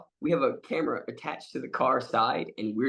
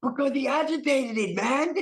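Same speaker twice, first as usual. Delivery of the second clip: Tommy impression; 9 semitones higher than usual. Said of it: "And Tommy's like".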